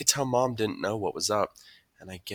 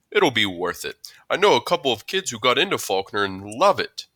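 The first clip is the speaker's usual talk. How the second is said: reassuring school principal; 4 semitones higher, 7 dB louder than usual. A narrator's reading. Mister Tushman voiced, reassuring.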